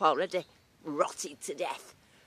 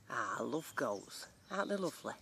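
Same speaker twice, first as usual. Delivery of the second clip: ordinary talk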